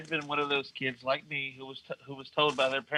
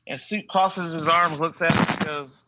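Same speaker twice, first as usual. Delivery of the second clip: usual speaking voice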